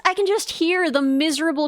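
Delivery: normal talking voice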